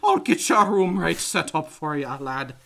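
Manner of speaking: mock Irish innkeeper welcoming guests; ridiculous Irish accent. Host imitating the Irish hotel owner, hammed-up Irish accent. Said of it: "ridiculous Irish accent".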